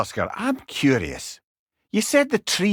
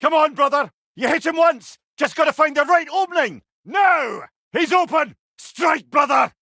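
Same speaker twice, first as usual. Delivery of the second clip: high, loud cries urging him to strike; rallying a fighting brother, shouting high and loud; battle shout; 12 semitones higher, 4 dB louder than usual. Acted. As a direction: yell encouragement across the fight.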